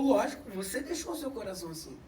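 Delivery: lightly voiced old man; grandfather answering. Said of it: "Ele falou".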